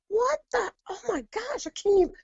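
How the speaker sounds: very high, frightened disbelief; squeaky, panicked retold gasp of words; retold freak-out. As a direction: relive the shock of the voices. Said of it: "and I was like".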